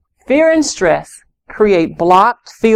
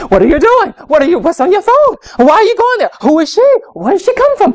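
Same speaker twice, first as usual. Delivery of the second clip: anxious interrogation; 9 semitones higher than usual. Acted. Anxious parent grilling her teen about phone and friends, high frantic questions. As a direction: grill the child in a panic.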